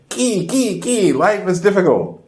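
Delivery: high, chirpy ki with mock glee; misplaced excited ki. Showing the wrong, cheerful yes to bad news, high. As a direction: chirp ki high where sympathy is due. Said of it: "You wouldn't wanna say".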